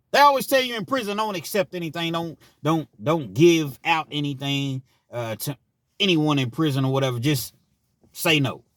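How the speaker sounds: a hint of a counsellor's lecture; mock warning voice. Lecturing prison rule.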